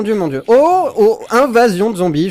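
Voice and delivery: high, loud alarm at the zombie invasion; alarmed shout. Real, not acted. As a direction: sound the alarm, voice jumping up. Zombies attaquent le village. (alerte criée, aiguë et forte)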